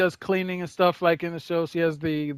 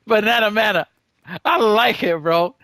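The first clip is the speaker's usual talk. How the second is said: excited; 4 semitones higher, 6 dB louder than usual. Real, not acted. Settling on pet's name happily.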